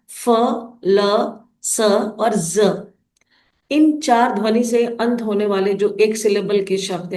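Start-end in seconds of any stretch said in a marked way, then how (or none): 0.1-2.9 "फ, ल, स और ज" stretched slowly, one by one; teacher slowly modelling "फ, ल, स और ज"; slow sounding out. Shown as a demonstration. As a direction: drag out each sound for the class. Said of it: "इन चार अक्षरों पर".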